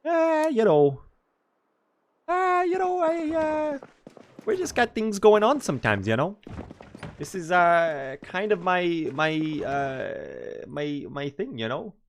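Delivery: high-pitched put-on Canadian character voice; Canadian accent. Shown as a demonstration. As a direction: try a hammy Canadian voice, up high. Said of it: "Canadian voice".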